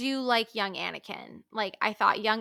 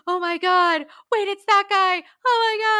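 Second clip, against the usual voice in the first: excited gasp; 9 semitones higher, 5 dB louder than usual. Retold or imitated. Reliving excited reaction in theater.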